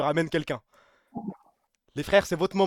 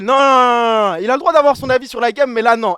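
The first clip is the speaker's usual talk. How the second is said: loud firm no; 6 semitones higher, 10 dB louder than usual. Real, not acted. Shutting down a hater, loud.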